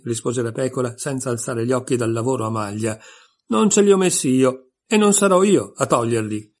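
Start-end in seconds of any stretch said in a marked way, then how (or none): 3.5-6.5 sheep retort. A narrator's reading.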